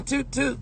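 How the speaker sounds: small, high, soft toot-toot; car horn imitation. Mocking other people's horns, high soft toots. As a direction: toot like a feeble little horn. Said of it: "y'all horn sound like that song".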